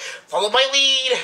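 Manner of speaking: high, loud, drawn-out nerd cry; nerdy geeky voice. Retold or imitated. Imitating a helicopter pilot's battle cry, nerdy and geeky. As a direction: call it out like an over-eager geek. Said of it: "in the most nerdy, geeky voice ever".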